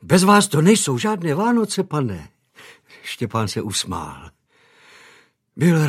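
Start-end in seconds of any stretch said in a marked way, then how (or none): 0.0-2.3 lightly voiced maid, higher and a bit brisk; a hint of a fussing housemaid; eager maid. A narrator's reading. Maid gently scolding young master, lightly voiced higher.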